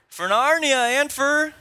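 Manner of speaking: high, loud, drawn-out charge call; rally the troops with a mock-heroic shout; battle cry. Preacher quizzing the room on Narnia films, shouting a battle cry. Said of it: "Chronicles of Narnia".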